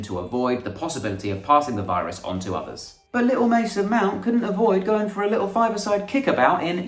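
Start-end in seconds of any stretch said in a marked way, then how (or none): 3.1-6.9 mock baby talk. Mocking little Mason Mount.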